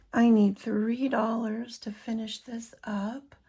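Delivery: ordinary delivery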